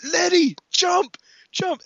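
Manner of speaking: high-pitched mock action-hero shout; urgent calling out. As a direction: call out as if warning her mid-chase. Dom calling to Letty mid-chase.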